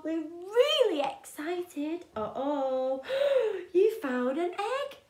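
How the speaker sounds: ordinary delivery, nothing marked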